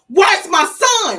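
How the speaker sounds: loud, high, slow anguished shout; desperate scream. Retold or imitated. Mother demands her son from his friend, screaming. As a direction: wail the demand at full volume.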